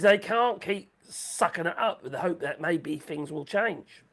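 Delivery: ordinary delivery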